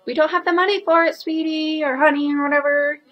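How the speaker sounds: fast, lightly voiced sweet parent; a hint of a soothing parent. Vlogger voices parents refusing the kid, lightly mock sweet. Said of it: "The parents are like".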